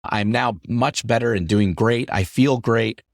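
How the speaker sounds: ordinary talk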